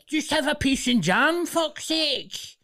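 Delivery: very high, loud squawking mock doctor; hammed-up high-pitched outburst; exasperated doctor. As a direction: shriek comic advice at a patient. Mock doctor shouts at worried patient.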